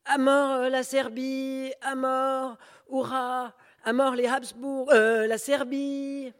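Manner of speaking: high, loud, drawn-out cries; rallying shouts. Acted. Demonstrator correcting himself, yelling to the crowd. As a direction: shout yourself hoarse for the cause. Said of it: "se ravise".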